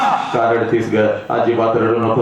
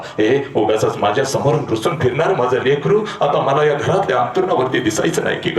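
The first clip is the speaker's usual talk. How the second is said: ordinary delivery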